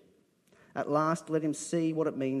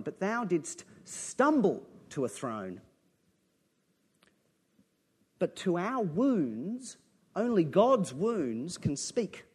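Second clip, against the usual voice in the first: poem reading; 5 dB louder, slower than usual. Acted. Preacher reciting a poem to his congregation, fervent and slow. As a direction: read the poem like a prayer rising.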